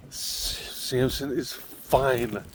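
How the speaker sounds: slow, hushed, half-whispered brush-off; mock the wife's sleepy irritation; wife half-asleep waving off the worry, slow mock whisper; annoyed sleepy reply. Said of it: "she was so annoyed".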